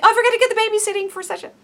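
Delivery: fast, high panicked remembering; flustered sudden recall. Retold or imitated. Distracted mind mid-prayer, fast flustered blurt. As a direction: blurt it out in a rush. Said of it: "you're like".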